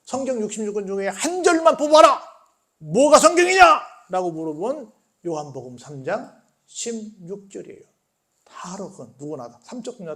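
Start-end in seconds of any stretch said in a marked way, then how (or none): 1.1-3.8 loud, raised-pitch demand; forceful challenge. Acted. Someone challenges believers to pick one Bible verse, forceful. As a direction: call it out like a challenge. Preacher acts out a challenging question.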